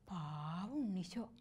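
whispered, soft and slow; pitying whisper. Acted. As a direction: hush it out in pity. Girl pities baby Jesus.

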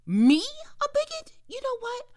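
loud, high, offended cry; indignant outburst. Acted. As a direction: flare up at the insult. Insulted by roommate.

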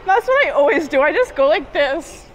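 loud laughing, high and amused. Real, not acted. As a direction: laugh it off, slowed at the end. Laughing about failed squat.

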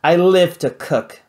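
loud, slow proud declaration; bellowing. Acted. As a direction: boom it out like a proud chef. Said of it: "bellowed Vlad".

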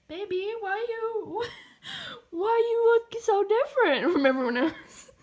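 high, loud playful whine; mock wail. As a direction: wail dramatically at your baby. Mock-wailing over favorite's odd picture.

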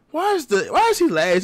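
rising, outraged why is he last; baffled protest that he came last; indignant question. Real, not acted. Outraged at ranking.

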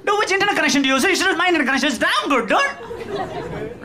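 high, laughing braggy voice; mock smug guest. Guest boasting to the host about his internet, high and giggly. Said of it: "and be like".

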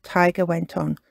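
plain reading voice

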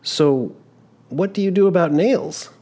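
put-on an embarrassed young man caught out; young traveler, flustered. Giovannino questions constable.

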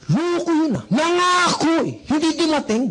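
high-pitched, indignant complaint; very angry grumbling. Acted. Networker at home after a no-show prospect, angry. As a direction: fume about being stood up. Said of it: "galit na galit ka".

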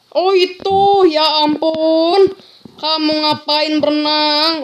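loud, slow, hammed-up scold; mock scolding. Gamer scolding a swimming villager in a loud mock voice. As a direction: play a stern elder scolding a truant.

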